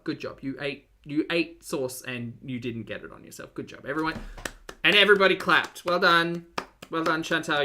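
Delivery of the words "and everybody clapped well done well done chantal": loud sarcastic cheer; mock cheering. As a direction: overdo the praise like a proud coach. Mock praise of eating neatly.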